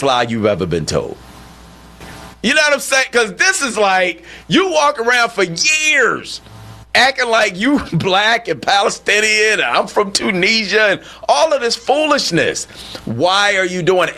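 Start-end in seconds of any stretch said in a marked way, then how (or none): 2.4-14.1 higher, incredulous scorn; host scoffing at years of pretending; exasperated disbelief. Real, not acted. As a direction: scoff up high at the foolishness. Exasperated rant continues.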